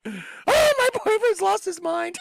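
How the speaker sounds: high, loud, hammed-up girlfriend voice; mock girlfriend. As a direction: squeal it like a shocked girlfriend. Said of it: "anyone that's standing there is like".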